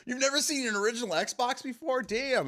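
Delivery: high-pitched, incredulous outburst; mock astonishment at a youngster; retold disbelief. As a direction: act out stunned disbelief, voice way up. Retells his disbelief at the kid.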